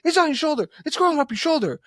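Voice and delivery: high, frantic mimicked warning; hammed-up panic in a high voice; imitated freak-out. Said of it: "loses her shit".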